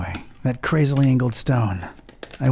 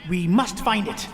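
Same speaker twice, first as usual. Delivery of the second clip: high, fervent zealot reading his diary; sinister cultist voice; 11 semitones higher than usual. Acted. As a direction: read it like a devoted cult member. Cultist diary voice, game journal.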